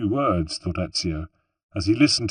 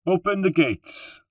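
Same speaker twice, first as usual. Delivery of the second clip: higher, slow command; lightly voiced captain; 10 semitones higher than usual. A narrator's reading. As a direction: give the order calmly. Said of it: "the captain of the guard said to his sergeant".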